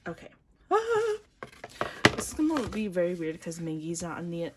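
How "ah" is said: a very high, loud ah; high excited ah. Real, not acted. Fan opens the album, high excited yelp. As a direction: yelp in delight. Reacting to the contents.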